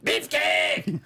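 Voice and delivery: high cartoon kid bellow; Eric Cartman. Capping a round of impressions, Cartman voice. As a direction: holler it as a cartoon brat. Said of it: "Kinda sounds like a Eric Cartman".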